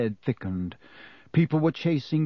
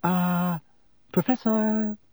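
high, tentative sing-song call; held-out, careful calling; cautious; 6 semitones higher, slower than usual. A narrator's reading. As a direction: try gently to get his attention. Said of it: "approached Professor Lupin cautiously".

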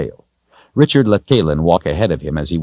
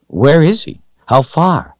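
eager, high-pitched questions; bright-eyed urgency, pitched up; excited; 12 semitones higher, 4 dB louder than usual. A narrator's reading. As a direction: ask keenly, voice lifted with anticipation. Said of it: "was excited about seeing Zed again".

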